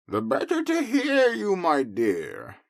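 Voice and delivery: wolf playing sweet granny; high fake granny voice; disguised wolf granny.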